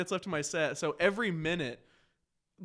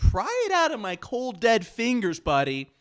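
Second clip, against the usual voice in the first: louder, slow, stubborn challenge to the crowd; mock defiant shout; 4 semitones higher, 9 dB louder, slower than usual. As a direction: dig in and throw it back at them. Defiant answer to hecklers, retold.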